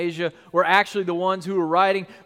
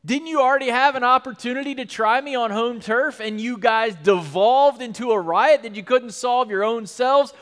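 louder, challenging sarcasm; Paul taunting accusers; 3 semitones higher, 5 dB louder than usual. Retold or imitated. Paul taunting the council before the governor, defiant. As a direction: twist the knife. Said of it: "Paul is basically gonna say".

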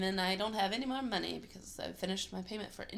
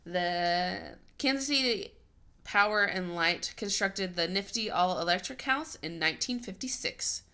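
ordinary talk

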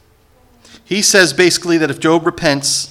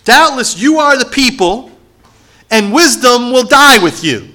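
high, loud mock praise dripping with sarcasm; sarcastic; 7 semitones higher, 4 dB louder than usual. Job mocking his friends, loud and sarcastic. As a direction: overpraise them so the scorn shows. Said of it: "he's flat out sarcastic".

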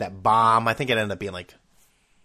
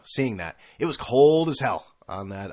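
ordinary delivery

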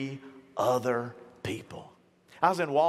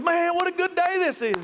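much higher, louder happy cry; fired-up joy; 12 semitones higher, 5 dB louder than usual. Retold or imitated. Preacher voicing a cheerful stranger in Walmart, high and loud. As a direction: burst out like the happiest man in line. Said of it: "he is just fired up".

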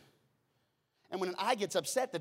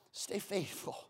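softly urging; tender encouragement; 5 dB quieter, slower than usual. Real, not acted. Pastor encouraging waiting believers, soft and tender. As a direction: say it gently, like a hand on the shoulder. Tender exhortation after sigh.

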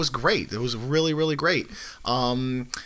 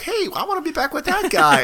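high pleading bird voice; parrot voice; 12 semitones higher than usual. Retold or imitated. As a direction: voice a needy cockatoo. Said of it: "he's looking at me like".

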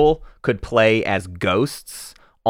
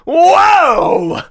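big high whoa of disbelief; shocked shout; 8 dB louder than usual. Real, not acted. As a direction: gasp it out loud. Shocked reaction.

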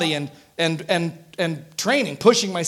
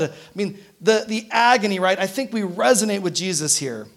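ordinary delivery

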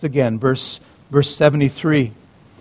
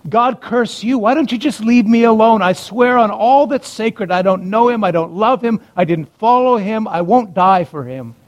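higher, heated protest; angry cursing; 6 semitones higher than usual. Acted. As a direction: swear it off at the accusers, fed up. Peter cursing his accusers.

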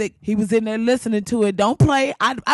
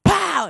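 sudden high pop sound; cannon pow; 9 semitones higher than usual. Retold or imitated. Cannon shooting headbands, sound effect.

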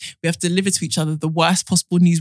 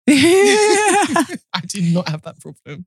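laughing while speaking, slow; 9 semitones higher, 4 dB louder, much slower than usual. Real, not acted. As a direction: laugh through the words. Laughing mock boast.

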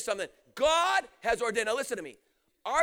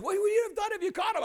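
higher-pitched imitation of a questioner; imitated wife's high-pitched question; Carol; 9 semitones higher than usual. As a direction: ask it in a raised, put-on voice. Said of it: "Carol asked me afterwards".

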